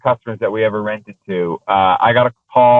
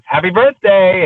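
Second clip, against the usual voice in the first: excited surprise; 8 semitones higher, 3 dB louder, slower than usual. Retold or imitated. Wife surprising senator with car.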